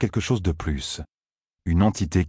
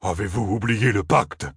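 slow, weighty reproach; stern Ogier rebuke; 2 semitones higher than usual. A narrator's reading. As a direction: remind the Aiel of the pact sternly. (reproche lent et grave)

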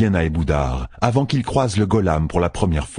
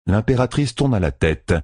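plain reading voice

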